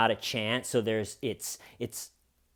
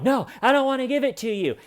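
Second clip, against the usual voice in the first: high, rushed imitation of a protest; mock an upset refusal, pitched up and quick; 12 semitones higher, 4 dB louder, faster than usual. Acting out Harvey refusing the phone.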